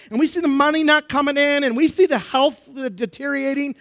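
high, mounting sermon list; impassioned preaching. Acted. Preacher to congregation on learning through brokenness, impassioned. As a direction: pile up the troubles urgently from the pulpit.

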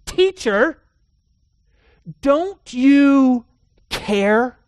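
high, loud, pleading accusation; accusatory cry. Retold or imitated. Frightened disciples waking Jesus in the storm, loud accusatory plea. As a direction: shout it at him like the boat is sinking. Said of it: "they have this accusatory tone".

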